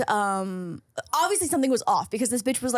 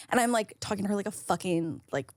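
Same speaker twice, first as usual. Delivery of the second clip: normal talking voice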